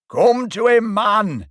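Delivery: big, loud, high Yorkshire bellow; Yorkshire shout. A narrator's reading. Yorkshire spectator bellowing at the players, loud Yorkshire. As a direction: roar it across the pitch in broad Yorkshire. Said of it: "in a great Yorkshire voice".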